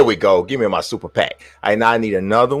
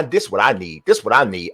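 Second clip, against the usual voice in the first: ordinary talk, nothing marked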